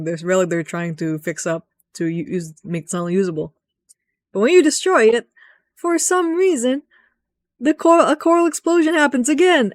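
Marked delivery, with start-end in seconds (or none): 4.3-9.7 much higher, louder rush; high excited telling. Real, not acted. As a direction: build up the big surprise. Excited retelling of a blast.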